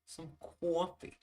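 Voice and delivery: high, far-off mock Long Island voice; Long Island girlfriend impression. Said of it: "It's like".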